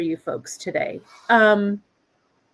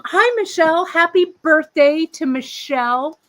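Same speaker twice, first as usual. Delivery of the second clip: high, loud birthday shout-out; excited greeting; 8 semitones higher, 6 dB louder than usual. Real, not acted. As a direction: greet her brightly and loud.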